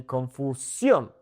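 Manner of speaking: Confusión slowly with a push on SYON; "Confusión" jumping onto the last syllable; jump on accent. Shown as a demonstration. Teacher shows the jump trick, Confusión punched on SYON. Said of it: "kinda jump".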